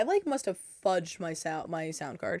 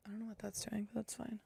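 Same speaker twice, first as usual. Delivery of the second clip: whispered aside; 14 dB quieter, faster than usual. Real, not acted.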